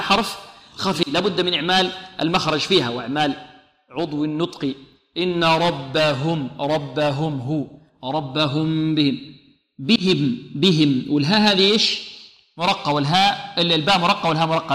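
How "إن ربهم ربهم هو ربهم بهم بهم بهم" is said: slow recitation with a distinct H in "ربهم" and "بهم"; clearly articulated haa. Shown as a demonstration. Teacher drilling the weak letter, slow clear H in "بهم". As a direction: bring out every H in "ربهم بهم". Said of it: "والهاء هذه لابد من إيضاحها".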